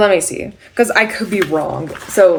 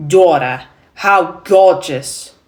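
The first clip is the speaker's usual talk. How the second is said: loud, slow New York accent; New York warm-up word into stage line; 7 dB louder, much slower than usual. Shown as a demonstration. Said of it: "when I say daughter with the New York accent".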